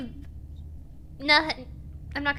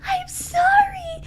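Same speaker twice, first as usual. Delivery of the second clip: mock sobbing; 12 semitones higher, 6 dB louder than usual. Player mock-cries an apology.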